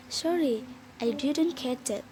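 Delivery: ordinary delivery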